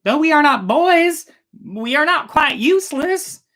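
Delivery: high, loud protesting princess; indignant young princess. A narrator's reading. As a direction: stand up for yourself and your sisters. Daughter insists on her worth.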